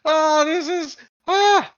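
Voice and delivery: very high, loud, gleeful shout; tiny goblin demanding the left head, high and thrilled; greedy giggling goblin. Acted. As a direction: gloat and grab with delight. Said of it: "being like".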